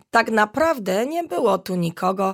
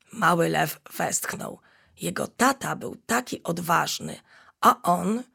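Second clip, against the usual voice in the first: usual speaking voice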